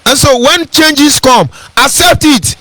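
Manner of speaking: lifted pulpit delivery; fervent preaching. Acted. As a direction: preach it to the crowd.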